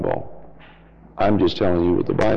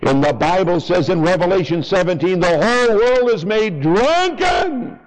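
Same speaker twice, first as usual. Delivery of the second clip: preaching at full force; 9 semitones higher than usual. Acted. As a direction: thunder it from the pulpit. Fiery sermon on religion.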